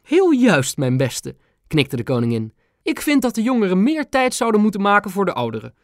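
high, regal grandmother; the queen confiding she's a grandma too. A narrator's reading. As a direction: speak as a touched old queen. Queen voiced by narrator.

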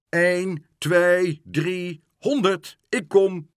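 a hint of a boy counting out loud; hide-and-seek counting. A workman counts for hide-and-seek, calling out playfully. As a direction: call out the count like a game.

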